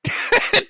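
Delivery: sudden high-pitched giggle. Real, not acted. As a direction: burst into a squeaky laugh. Commentator laughing at Ralph's rage.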